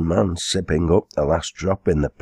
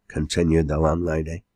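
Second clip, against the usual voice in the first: ordinary delivery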